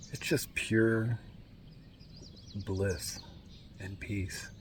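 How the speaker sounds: hushed whisper, very soft. Real, not acted. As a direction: breathe the words out, barely voiced. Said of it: "I don't know why I'm whispering".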